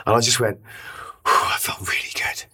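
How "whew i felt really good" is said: whispered, spent relief; a hushed exhale of satisfaction; breathless whisper. Retold or imitated. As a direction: let it out on a breath, wrung out. Relief after the fight.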